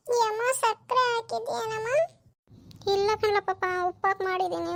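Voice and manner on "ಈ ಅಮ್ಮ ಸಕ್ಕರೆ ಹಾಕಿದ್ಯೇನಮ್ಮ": put-on higher little child voice; child questioning mother about a drink; young child. (ಅಮ್ಮನನ್ನು ಕೇಳುವ ಮಗುವಿನ ಧ್ವನಿ)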